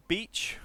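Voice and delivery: beach with a long ee, carefully said; demo word. Shown as a demonstration. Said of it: "let me say some words".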